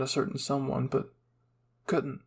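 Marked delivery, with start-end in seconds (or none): none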